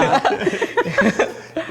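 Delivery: long high embarrassed laugh; a real shy laugh; bashful laugh. Real, not acted. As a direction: laugh off the teasing. Laughs about being the lucky one.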